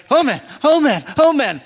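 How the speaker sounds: high, loud mock panic; retold panic. Rider hits a rock and tips over, yelping in mock panic. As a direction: yelp it as you start to topple. Wobbling into a rock, about to fall.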